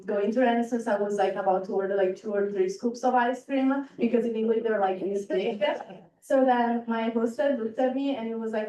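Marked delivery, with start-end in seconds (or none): none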